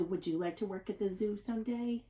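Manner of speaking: ordinary delivery, nothing marked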